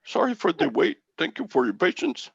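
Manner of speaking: put-on high-pitched, polite Indian-accented agent voice; imitated Indian accent. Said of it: "you could do that accent".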